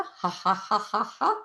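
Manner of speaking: measured ha-ha, climbing; deliberate exercise laugh; laughter. Shown as a demonstration. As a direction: laugh in even pouring beats. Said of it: "Pour one into the other with laughter".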